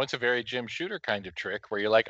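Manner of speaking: ordinary talk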